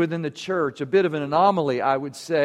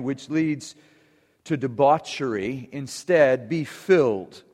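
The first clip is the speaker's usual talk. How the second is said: measured, solemn public Bible reading; lower, unhurried reading that softens toward the close; scripture reading; 4 semitones lower than usual. Acted. Reads scripture aloud to congregation.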